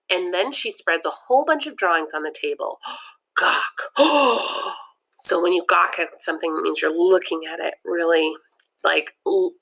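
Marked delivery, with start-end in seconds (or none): 3.4-4.8 sharp awed intake of breath; gasp of awe. Acted. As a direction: gasp in amazement. Kids gawking at drawings.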